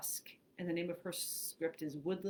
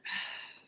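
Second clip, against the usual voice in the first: breathy release of a full breath; long sigh out. Shown as a demonstration. Meditation leader breathes out along with listeners, long sigh. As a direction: sigh it all the way out. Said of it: "sigh it all the way out".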